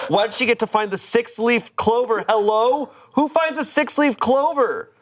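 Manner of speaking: high, hammed-up jealous outrage; indignant disbelief. Jealous poster questions girlfriend's luck, high indignant whine. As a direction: push the pitch up, incredulous.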